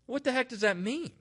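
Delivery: high-pitched, baffled rhetorical question; incredulous. Real, not acted. Speaker questioning a change in usage to listeners, incredulous. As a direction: pitch it way up in disbelief.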